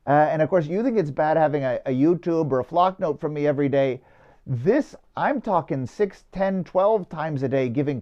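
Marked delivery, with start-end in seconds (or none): none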